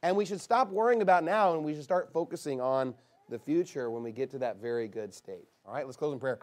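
normal talking voice

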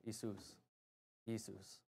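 "Jesus" said very softly as "ee-SOOS"; hushed "Jesus" with no J, ee-SOOS, twice; Greek pronunciation. Shown as a demonstration. Preacher prompting the Greek name, "Jesus" as a quiet ee-SOOS. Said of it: "And then in Greek".

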